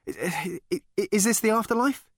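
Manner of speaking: high, fast, tripping stammer. Acted. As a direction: stumble over the first word. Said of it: "he stammered".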